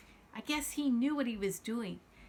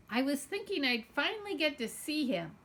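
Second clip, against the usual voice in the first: ordinary delivery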